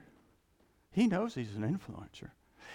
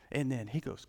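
normal talking voice

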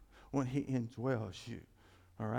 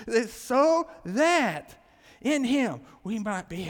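"so that" drawn out slow and loud; emphasized words; 8 semitones higher, 11 dB louder, much slower than usual. Shown as a demonstration. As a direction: hammer home "so that in him" slowly. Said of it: "see how I emphasize the next words".

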